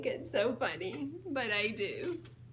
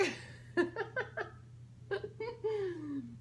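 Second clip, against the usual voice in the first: soft delighted laughter; amused laugh; 7 semitones higher, 4 dB quieter than usual. Real, not acted. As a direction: giggle at the pun. Laughs at guard bark pun.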